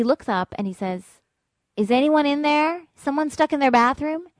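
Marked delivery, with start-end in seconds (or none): 1.8-4.3 calling up. Retold or imitated. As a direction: call out to find whoever is stuck. Uniformed man calling up to window.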